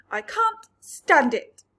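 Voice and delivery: slow, loud anguished wail. A narrator's reading. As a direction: wail in distress. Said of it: "wailed Earthworm".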